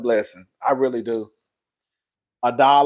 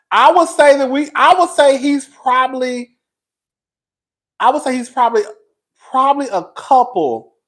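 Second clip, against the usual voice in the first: emotional; 8 semitones higher, 5 dB louder than usual. Real, not acted. Emotional outburst while crying.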